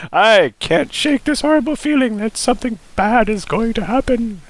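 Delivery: hammed-up sobbing girl voice. A narrator's reading. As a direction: whimper it like a frightened girl. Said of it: "she sobbed".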